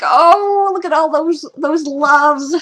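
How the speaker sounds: high, loud gushing over the siblings. Retold or imitated. Fan gushing at the siblings' loving scene, high and excited. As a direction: coo over them like a fan.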